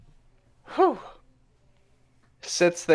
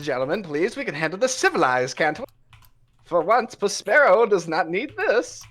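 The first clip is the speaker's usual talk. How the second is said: pleading rogue; 7 semitones higher, faster than usual. Acted. Prospero pleading.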